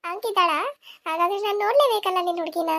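normal talking voice

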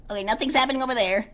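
ordinary delivery

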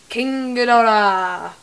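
drawn-out, big reveal of the name; slow, loud, proud reveal; excited announcement. Real, not acted. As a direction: present the prize with a flourish.

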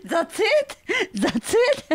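high, laughing That's it; incredulous laughing; laughing disbelief. Real, not acted. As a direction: laugh out the question. Amused disbelief at the ending.

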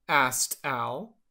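ordinary delivery

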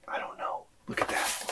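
hushed whisper to himself. Real, not acted. Alone with the bottle, he whispers to himself. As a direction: breathe the words, barely there.

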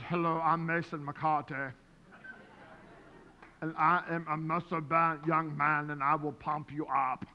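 low, puffed-up strongman voice; imitating his muscle-bound son. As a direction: show off like a muscle-bound young man. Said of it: "he walks around like this".

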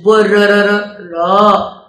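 stretched, loud letter sounds; sounding out letters. Shown as a demonstration. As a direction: sound out each letter slowly for children. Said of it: "برج".

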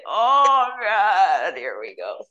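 loud drawn-out groan sinking low; weary "oh God" with falling pitch; effortful groan. Real, not acted. Owns being old lady while moving.

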